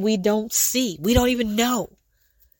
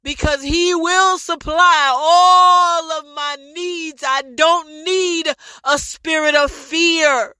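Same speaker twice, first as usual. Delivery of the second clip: louder, higher fervent proclamation; shouted declaration; 5 semitones higher, 4 dB louder than usual. Real, not acted. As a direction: proclaim it boldly to the room.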